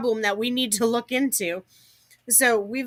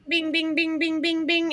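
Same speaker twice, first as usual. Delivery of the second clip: high repeated bings like a phone; ping it out high and steady; high ping imitation; 7 semitones higher than usual. Mimicking the repeated notification pings.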